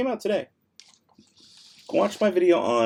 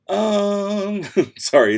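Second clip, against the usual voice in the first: laughing; 7 semitones higher, 8 dB louder, much slower than usual. Real, not acted. Laughs while apologizing.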